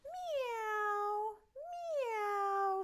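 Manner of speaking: high drawn-out meow; cat meow. Acted. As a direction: mew like a lazy cat. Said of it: "said Cat".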